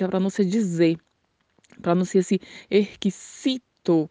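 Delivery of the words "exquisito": "erquisito" with an unvoiced S instead of Z; Spanish S sound. Shown as a demonstration. Teacher shows learners the Spanish word, "erquisito" with an S sound. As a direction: sound out "erquisito" slowly, faded X, crisp S. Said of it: "como se fosse o som de S".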